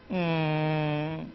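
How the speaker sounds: soft, short whimper; a small sulky hum of a cry; child's pouty whimper. Shown as a demonstration. Teacher demonstrating a child's comic cry. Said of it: "the child says".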